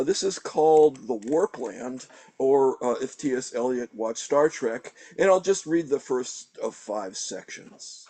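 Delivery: usual speaking voice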